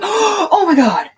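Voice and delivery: high, loud, slow gasped cry; startled cry at nearly being shot; retold shock. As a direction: stretch it out in terror. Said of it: "I was like, gasps".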